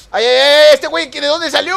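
high, loud, shocked outburst; startled yelling. Real, not acted. Player startled by someone appearing from nowhere, loud high yell. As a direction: yell as if ambushed.

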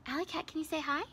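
usual speaking voice

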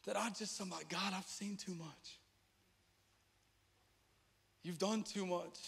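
soft prayerful admission; hushed confession. Real, not acted. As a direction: confess it softly to God. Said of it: "this is my heart".

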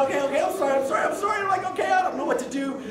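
high, hurried, repeated apologies; panicked apology. Retold or imitated. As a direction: babble it in a mock panic. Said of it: "I'm like, a panicked".

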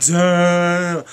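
loud stretched-out exclamation; awed damn. Retold or imitated. Fan reacting to girl groups, loud awed damn. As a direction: drag it out amazed. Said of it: "I'm like".

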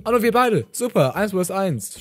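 high, loud exclamation; excited realisation. Real, not acted. Gamer finding only two players left, excited outburst. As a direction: call it out on spotting the duel. Notices a one-on-one in game.

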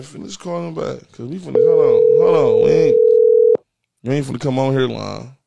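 usual speaking voice

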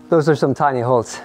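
normal talking voice

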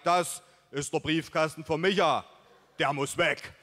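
put-on, emphatic buddy voice; rustic buddy Mike. A narrator's reading. Buddy presenting photos of the target mailbox, emphatic put-on voice. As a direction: declare it like a man on a mission.

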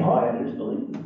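hushed sarcastic whisper; mock whisper. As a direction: breathe it out like a sly secret. Mocking repeat of easy belief.

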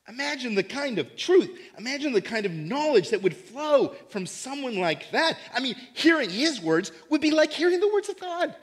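as a stunned listener grasping the news, voice rising; awed Greek audience. Retold or imitated. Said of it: "John's Greek audience would be saying".

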